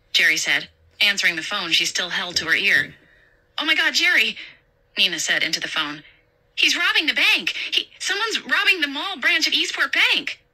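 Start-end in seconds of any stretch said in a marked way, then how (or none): 3.6-10.4 put-on high, urgent, frightened girl; a young clerk phoning in a panic; panicked young woman. Nina voiced in panic.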